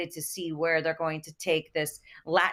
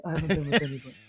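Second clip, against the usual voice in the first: lower, earnest mock reassurance; lower sincere lie; 5 semitones lower, much faster than usual. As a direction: drop the voice to sell the lie. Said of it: "straight, sincere lie".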